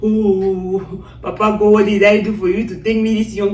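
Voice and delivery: mild retold wail to God; lightly acted tearful complaint, opening on a long oh; crying lament. Woman asking God why she is taken young, retold crying. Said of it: "This me crying now".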